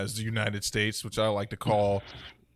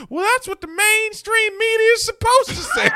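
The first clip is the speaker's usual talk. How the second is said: much higher, loud, squeaky mocking voice; mock believer's voice; 7 dB louder than usual. Imitating a newly recruited woman on TV: high squeaky mock. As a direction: ham up the true believer's smug answer. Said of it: "And she's like".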